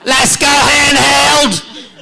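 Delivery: put-on high, loud shout in an Australian accent; hammed-up Australian yell, stretched at the end; Baz. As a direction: holler like a gung-ho Australian director. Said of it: "And he's like".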